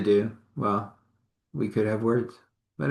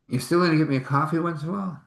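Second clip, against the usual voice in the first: teasing guard; 7 semitones higher, much faster than usual. Retold or imitated. Guard asks her, teasing.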